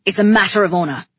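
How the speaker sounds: loud, quick, angry insistence; snapping. A narrator's reading. King insisting on honour to his brother, snapping. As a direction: fire it out fast and loud. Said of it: "he snapped".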